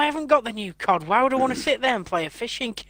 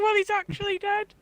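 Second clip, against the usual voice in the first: mock announcement; 13 semitones higher, 5 dB quieter than usual. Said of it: "I thought you were gonna say".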